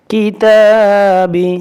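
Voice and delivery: কিতাবি with the long vowel stretched slowly; drawn out kitabi. Shown as a demonstration. Teacher demonstrating to students, slow stretched recitation. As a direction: hold the vowel of কিতাবি one alif long. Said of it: "এক আলিফ পরিমাণ টেনে পড়তে হবে".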